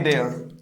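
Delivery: देयर dropped to a near whisper; देयर with an open e sound; soft model word. Shown as a demonstration. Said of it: "देयर बोल सकते हैं".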